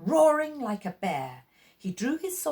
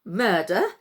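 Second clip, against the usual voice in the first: amused scoff; 6 dB louder than usual. Acted. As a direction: toss the word back, amused. Puppet master scoffs.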